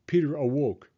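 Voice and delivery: ordinary delivery, nothing marked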